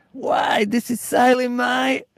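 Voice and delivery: high-pitched yell over roaring sea; luide kreet. A narrator's reading. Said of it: "He yells over the noise".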